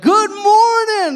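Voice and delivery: loud, high, drawn-out mock greeting; chipper early bird. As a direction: sing out an over-cheery wake-up call. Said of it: "that early, early bird, and they're like".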